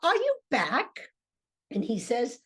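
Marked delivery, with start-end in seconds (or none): 0.0-1.1 slow, higher questioning voice; Meg peering out. Acted. Wife peering through the hatch at her husband, slow and high. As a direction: ask slowly and high, face in the hatch. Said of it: "And she says".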